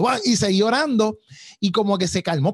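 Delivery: ordinary talk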